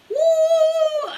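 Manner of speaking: long, high, loud "woo"; over-excited whoop. Shown as a demonstration. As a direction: let out a sustained whoop of wild excitement. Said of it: "Byron doesn't get, like".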